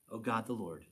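soft, subdued address to God; calming down. Acted. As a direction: settle into a quiet, humbled prayer. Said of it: "all worked up, and then he remembers".

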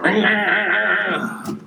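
high, drawn-out buzzing saw noise; power saw imitation. Preacher mimicking his neighbor's early-morning power saw, drawn-out imitated buzz.

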